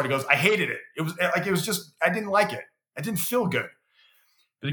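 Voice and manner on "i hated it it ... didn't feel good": retold griping. Friend complaining about hated workout.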